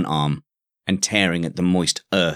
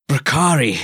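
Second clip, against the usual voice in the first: high breathless cry, word half lost to gasping; 6 semitones higher, 4 dB louder than usual. A narrator's reading. York, out of breath, calling up to the keep, a gasping cry. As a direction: call out while gasping for air. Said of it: "he cried out".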